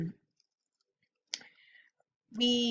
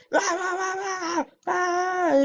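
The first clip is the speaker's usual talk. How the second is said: mock clown roar; 10 semitones higher, much slower than usual. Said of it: "he's like".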